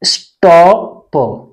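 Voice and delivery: स्टॉप very slowly, in parts; sounded out. Shown as a demonstration. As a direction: stretch स्टॉ, then a separate प. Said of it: "किससे पढ़ेंगे". (स्टॉ... प, धीरे-धीरे तोड़कर)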